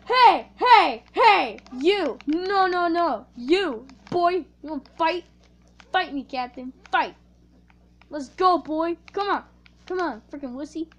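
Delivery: loud shouted dare to fight; taunting challenge. Acted. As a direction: goad him into a fight.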